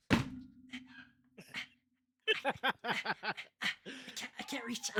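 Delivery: high, broken stammer of mock effort; straining to reach. Mimicking a short wrestler attempting a shoulder rub, straining. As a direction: imitate someone small stretching in vain.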